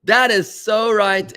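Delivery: high, loud yes, then a slow, savoured answer; delighted agreement. Retold or imitated. Rabbi recalls answering his friend Larry, delighted and emphatic. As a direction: burst out in joy at the perfect answer. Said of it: "I'm like".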